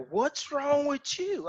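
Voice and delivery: high, slow, drawn-out disbelief, imitated; puzzled onlookers. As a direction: question them slowly, as if baffled. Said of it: "people are looking at me like".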